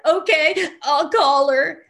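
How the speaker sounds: high, loud whiny agreement; reluctant giving in. Retold or imitated. Giving in to her sponsor, whiny and reluctant. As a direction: cave in with a drawn-out whine. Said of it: "But what came out is".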